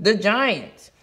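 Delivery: plain reading voice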